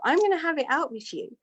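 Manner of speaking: worked up, retelling a decision to confront; worked-up retold resolve. Woman resolving to confront the guru, worked up. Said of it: "I'm really losing it now".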